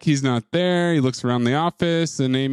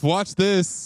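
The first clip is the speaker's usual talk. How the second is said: ordinary talk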